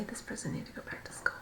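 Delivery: fast whispered jab under the breath; whispered aside. Real, not acted. Whispered aside about reader.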